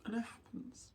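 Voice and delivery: choked-up whisper; tearful whisper. Real, not acted. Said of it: "I've been crying a lot today".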